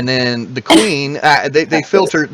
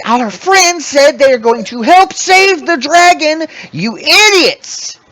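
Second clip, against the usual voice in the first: barking kobold queen; 12 semitones higher than usual. Acted. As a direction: bark the scolding at her troops. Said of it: "she barks out".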